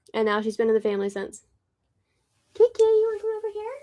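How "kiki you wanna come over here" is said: quick, much higher pet voice; calling the kitty. Real, not acted. Calling Kiki over in a high pet voice. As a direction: coax her over fast and sweetly.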